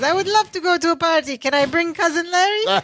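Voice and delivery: high, quick imitated sitcom innocent; Balky. Innocent Balky accepting the party invitation, high imitated voice. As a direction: raise the pitch and hurry into an eager question. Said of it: "And Balky says".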